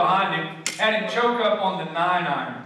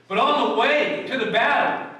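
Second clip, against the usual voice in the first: raised, ringing preacher voice, higher and louder; full-force preaching; 7 semitones higher, 4 dB louder than usual. Acted. Preacher to his congregation, booming and raised. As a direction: proclaim it to the whole congregation.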